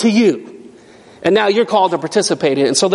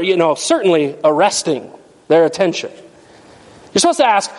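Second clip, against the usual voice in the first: normal talking voice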